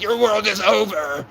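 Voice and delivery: retold defiant threat, lightly acted; mock threat. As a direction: taunt him slowly and pointedly. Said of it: "I'm like".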